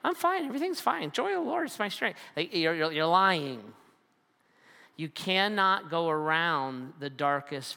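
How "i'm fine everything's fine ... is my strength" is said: high, fast, sing-song fake cheer; mock cheerful denial. Preacher mocking a believer's forced cheer. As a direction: brush it off in a chirpy false voice.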